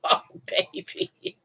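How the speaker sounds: breathy whisper to the dog. Real, not acted. Owner whispering to her dog. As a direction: whisper it slow and soft.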